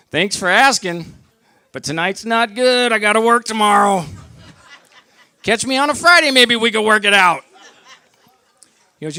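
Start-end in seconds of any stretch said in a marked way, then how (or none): 0.1-7.4 high, loud, breezy brush-off; mock polite decline. Driver answering a cop's offer of jail, loud breezy decline. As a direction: turn it down loudly, as if declining a social invite.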